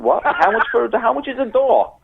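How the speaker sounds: high, incredulous hotel clerk; baffled clerk. Retold or imitated. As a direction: squeak it out in total disbelief. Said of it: "The woman".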